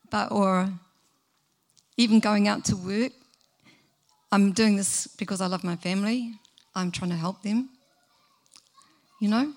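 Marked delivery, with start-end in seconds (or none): none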